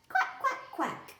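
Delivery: high quacks with a laugh; laughing duck quacks. Acted. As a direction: giggle through the duck's quacks. Animals cheer in the story.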